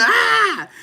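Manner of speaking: high, short imitated scream of someone being whipped; mock scream. Imitating someone whipped with a belt, mock scream. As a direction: let out a playful pained yelp. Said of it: "have 'em around there screaming".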